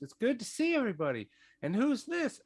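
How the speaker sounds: very high, fast little satyr. Acted. Little satyr Zontan greeting everybody, high and fast. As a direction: squeak it out like a small satyr. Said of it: "Zontan just goes".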